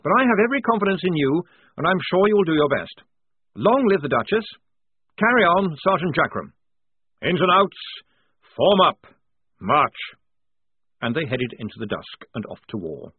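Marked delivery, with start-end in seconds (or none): 0.0-6.5 high, loud brisk rally; rally the men and march; hearty lieutenant's send-off; rousing officer. A narrator's reading. 7.2-7.8 higher, louder eager call; lieutenant's borrowed drill call; officer copying the call. A narrator's reading. 8.6-10.0 high, loud brisk rally; rally the men and march; hearty lieutenant's send-off; rousing officer. A narrator's reading.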